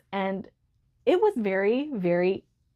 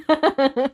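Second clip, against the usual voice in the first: laugh; 6 semitones higher, 6 dB louder than usual. Real, not acted. Laughing to herself.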